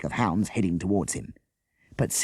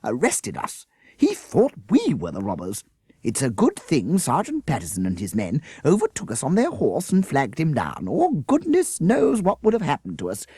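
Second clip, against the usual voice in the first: indignant teacher; 7 semitones higher, 4 dB louder than usual. Acted.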